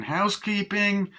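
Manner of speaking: high, slow put-on call; maid calling out. Maid coming in next morning, high drawn-out call. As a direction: sing it out at the door. Maid calls out entering room.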